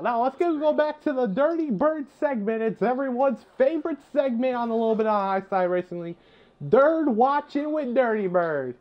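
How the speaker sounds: high hyped-up segment announcement; big show host intro, slowing on the title; announcer voice. Acted. Relaunches the bird watching segment.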